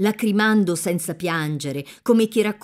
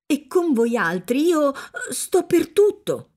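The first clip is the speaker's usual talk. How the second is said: a touch of crying in the voice; mother half in tears promising to follow; tearful; 7 semitones higher than usual. A narrator's reading. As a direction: voice catching with mild emotion. Agnese moved while pledging devotion.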